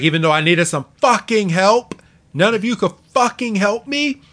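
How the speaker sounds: imitating a wrestler who feels abandoned; exasperated rant. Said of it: "He's like".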